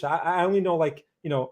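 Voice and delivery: ordinary talk, nothing marked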